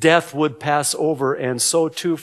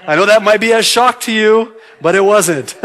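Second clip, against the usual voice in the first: louder, higher, breaking into a laugh; amused teasing; 6 semitones higher, 8 dB louder, faster than usual. Real, not acted. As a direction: tease them loudly, laughter creeping in. Teasing congregation about Halloween.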